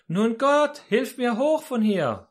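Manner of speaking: high, drawn-out acted plea; pleading call. Hanging man begs God to pull him up, high pleading call. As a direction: call up to be hauled to safety.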